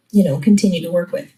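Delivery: normal talking voice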